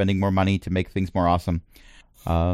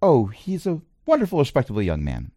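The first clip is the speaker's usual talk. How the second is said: posh voice; 4 semitones higher than usual. Acted. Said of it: "this is Crash saying".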